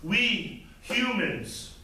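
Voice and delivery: solemn poem recital with spaced phrases; poetry reading. Acted. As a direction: read an elegy aloud, measured and projected. Reads own elegy aloud.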